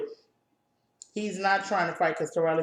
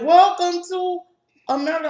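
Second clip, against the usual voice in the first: high, loud mocking welcome; loud put-on bus driver voice; 12 semitones higher, 9 dB louder than usual. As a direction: belt it out like a pushy bus driver.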